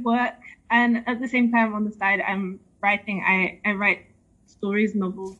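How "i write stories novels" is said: ordinary talk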